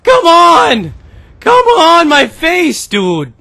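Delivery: loud, high, drawn-out protest; exasperated yell. Real, not acted. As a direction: yell in outrage at getting killed. Protests being killed in game.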